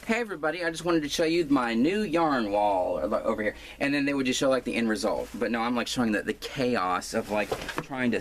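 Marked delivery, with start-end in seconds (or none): 0.1-3.0 mock YouTuber greeting. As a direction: parody the typical cheery vlogger intro. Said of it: "most people would say".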